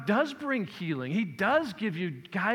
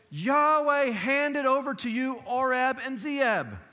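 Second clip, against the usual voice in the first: proclaiming Gideon; 5 semitones higher, 5 dB louder than usual. Acted. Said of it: "he says".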